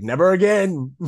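mock indignant vow. Voicing the Matthews' mock vow.

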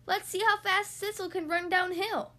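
high, eager calling; excited yell. A narrator's reading. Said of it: "Duck yelled".

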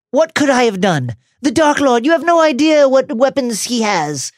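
high, desperate cowering plea; panicked pleading. A narrator's reading. Peter cracks, pleading.

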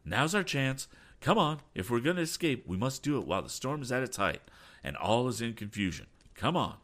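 rally everyone to escape now; excited captain urging his men on; exclaiming. A narrator's reading. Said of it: "exclaimed Captain Weston".

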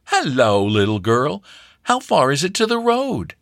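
lightly voiced friendly young man; cheerful, persuasive. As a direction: coax the shy girl cheerfully. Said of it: "called out in a very cheerful and persuasive tone".

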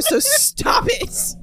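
slow, high, breathless through laughter; begging through giggles; laughing plea. Real, not acted. Host begging cohost to stop making her laugh, laughing.

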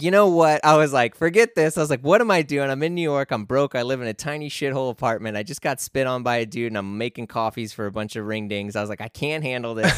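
loud, high frustrated rant; exasperated barista quitting on the spot; fed-up outburst. Retold or imitated. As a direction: blurt it out, fed up and done.

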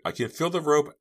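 high, loud, eager; excited stammer. A narrator's reading. Girl sawing her ropes on chain, excited. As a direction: raise it with breakthrough excitement. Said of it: "Jade stammered".

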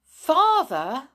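high, loud anxious call; frightened. A narrator's reading. Girl whose father will not answer her, frightened. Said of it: "quite frightened".

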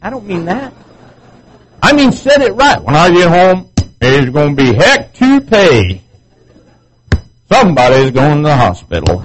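loud, high, furious ranting husband; hammed-up angry threat. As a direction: rant like a wronged husband threatening payback. Preacher acting angry husband.

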